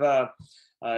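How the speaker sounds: usual speaking voice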